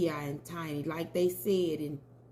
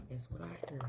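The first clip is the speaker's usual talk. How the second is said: whisper; 3 semitones lower, 12 dB quieter, much faster than usual. Real, not acted. Aside about spirit message.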